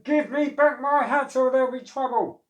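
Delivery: loud, high angry threat. Acted. Angry hat seller threatening the monkeys, loud high shout. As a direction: threaten them, stick waving. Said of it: "The man was angry".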